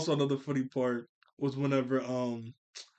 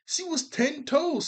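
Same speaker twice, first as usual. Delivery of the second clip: hyped praise; 13 semitones higher, 3 dB louder, slower than usual. Real, not acted. Hyping up the loyal wife.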